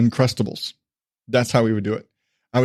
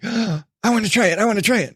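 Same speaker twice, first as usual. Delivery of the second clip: put-on 1 excited little kid; 7 semitones higher than usual. Son begging to taste jelly.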